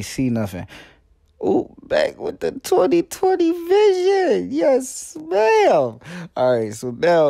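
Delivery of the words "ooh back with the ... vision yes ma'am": high, slow, put-on diva voice; sassy show-off. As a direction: strut it like a diva, drawn out high. Back with new contacts, showing off.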